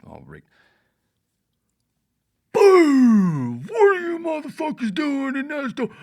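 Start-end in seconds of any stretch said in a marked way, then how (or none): 2.5-5.9 very high, very loud hijacked-speaker yell; booming shout. Retold or imitated. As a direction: blast it like over a PA. Steven hijacks speakers, yelling.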